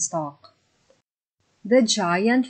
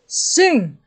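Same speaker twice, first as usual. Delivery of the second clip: higher, louder single order; giant's command; 7 semitones higher, 6 dB louder than usual. Acted. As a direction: call it out sharply like a giant's command.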